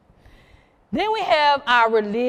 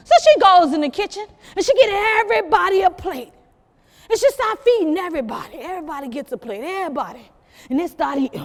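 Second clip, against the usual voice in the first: ordinary talk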